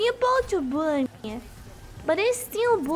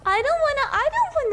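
high, loud cry of no; a loud, high no to the teammate; loud protest; 8 semitones higher, 5 dB louder than usual. Real, not acted. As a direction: push back hard and loud. Upset at teammate in voice chat.

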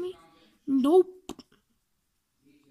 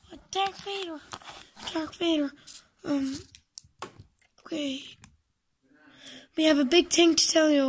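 normal talking voice

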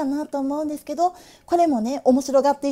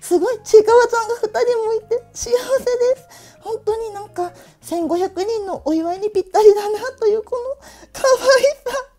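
gushing; 6 semitones higher than usual. Real, not acted. Gushing over cute figure.

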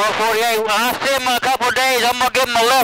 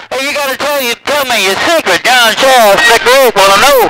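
calling out; 8 dB louder than usual. Real, not acted. Calling over CB radio.

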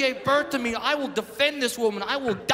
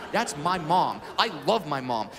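usual speaking voice

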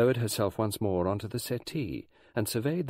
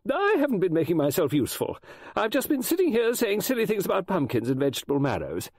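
high, flustered apology; self-reproachful older guest, pitched high; apologetic; 11 semitones higher, 4 dB louder than usual. A narrator's reading. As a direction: apologise in a raised, fussing voice. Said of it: "rose from the settee apologetically".

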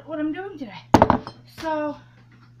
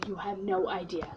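usual speaking voice

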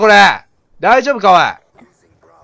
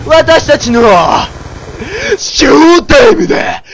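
yakuza-style battle cry; 4 semitones higher, slower than usual. Acted. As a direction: roar it like a yakuza boss opening the show. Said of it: "すっげえ声してんな、ヤクザもん". (ヤクザ風にゆっくり叫ぶ)

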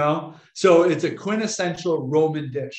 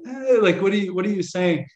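puzzled listener; 5 semitones higher than usual. Retold or imitated.

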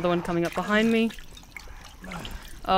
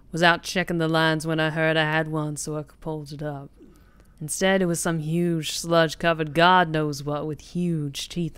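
put-on Cajun accent; 5 semitones lower than usual. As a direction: read it in a gruff Cajun accent. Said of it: "do a little bit of a Cajun accent".

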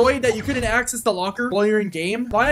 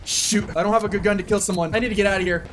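normal talking voice

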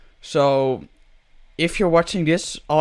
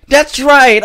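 excited cheer; 11 semitones higher, 11 dB louder than usual. Real, not acted. Cheering at the game.

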